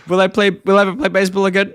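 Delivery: quick, lightly voiced cartoon boy; Bart. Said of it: "the way that Homer plays that".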